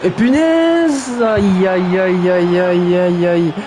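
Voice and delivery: very high, stretched punaise then repeated aïe; dismayed cry. Real, not acted. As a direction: wail over a blown chance. Commentator reacts to a miss. (commentateur qui se lamente, punaise très aigu et étiré puis aïe répétés)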